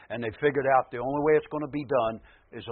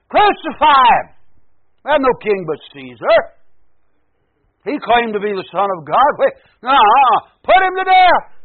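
high, loud crowd condemning a man; full-force demand for an execution; angry mob shout; 11 semitones higher, 15 dB louder than usual. Acted. Acting out crowd demanding crucifixion.

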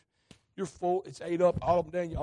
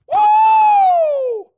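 high, very loud whoop of praise; joyful whoop; 21 dB louder than usual. Real, not acted. Preacher whooping for joy at the healing. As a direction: let out a holy whoop.

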